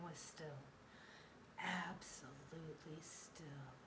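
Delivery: slow, soft, suspenseful; hushed stillness. A narrator's reading. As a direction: draw it out in a hush. Everything stops after spinning.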